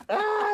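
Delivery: sudden high pained cry; high mock yelp. Retelling the napalm fog, high yelp. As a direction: squeal like it burns. Hot fog burning him.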